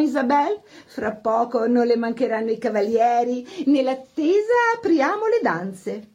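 brisk party hostess. A narrator's reading. Madame Kampf urges guest and orchestra to begin, brisk hostess. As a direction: take charge and get the dancing going.

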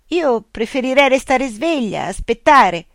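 sleepy yawning protest; already yawning. A narrator's reading. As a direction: fight off sleep while speaking. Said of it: "ma già sbadigliando".

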